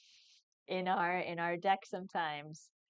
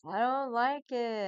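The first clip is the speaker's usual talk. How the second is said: dramatic dismay; 5 semitones higher, 7 dB louder than usual. Real, not acted. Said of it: "she did not like me getting excited".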